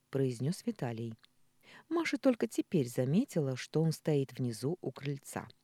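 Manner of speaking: usual speaking voice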